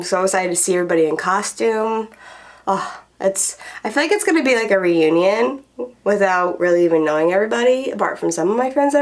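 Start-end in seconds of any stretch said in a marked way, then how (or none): none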